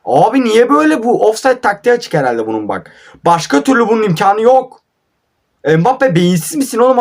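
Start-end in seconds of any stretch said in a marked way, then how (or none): 0.1-2.1 high, louder exasperated cry; frustrated outburst. Real, not acted. Gamer raging at his own team, high frustrated outburst. 3.3-7.0 high, louder scolding of the player; angry rant. Real, not acted. Gamer scolding Mbappe on screen, high angry rant.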